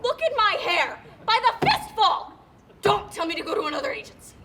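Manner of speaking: loud and desperate, slowing on the fistful; desperate loud cry. Acted. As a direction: wail it out as if pulling your hair out. Tearing hair out, desperate.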